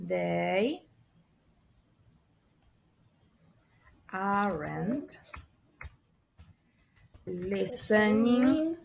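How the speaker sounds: word by word, long pauses between; slow dictation. Shown as a demonstration. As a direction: stretch out each word as if writing it. Dictates sentence while writing it out. (dictado lento, palabra por palabra)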